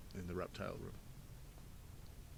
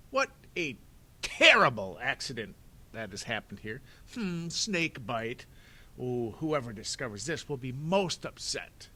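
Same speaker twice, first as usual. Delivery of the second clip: a villain overacting his dismay, drawing out "Hmm, snake bite"; fake surprise; 2 semitones higher, 8 dB louder than usual. Stefano faking surprise over the body to the children. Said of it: "pretending to be surprised".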